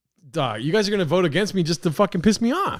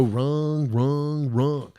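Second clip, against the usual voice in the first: mocking imitation; 4 semitones lower, much slower than usual. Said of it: "you go".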